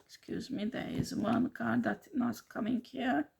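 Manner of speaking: hushed whisper to herself; muttered aside over the cards. Real, not acted. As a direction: drop to a near-silent whisper. Aside while laying out cards.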